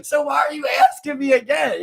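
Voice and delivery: high, loud mock exasperation; fed-up demand for an answer; exasperated rant. Worker asking coworker why he keeps asking, exasperated.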